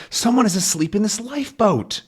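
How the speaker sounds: high-pitched acted astonishment; surprised. Character discovering a sleeper in the lifeboat, surprised. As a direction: exclaim in storybook surprise.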